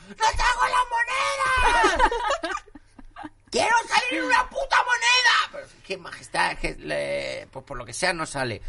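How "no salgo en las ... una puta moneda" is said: screaming queen. Pretend. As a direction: shriek so the whole palace hears. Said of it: "Que se la oiga gritando". (reina chillando que quiere salir en una moneda)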